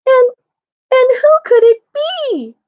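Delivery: put-on very high, loud Baby Bear; tiny bear piping up with a question; wee little voice. A narrator's reading. Baby Bear wonders who is in his bed, wee little voice. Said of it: "in his wee little voice".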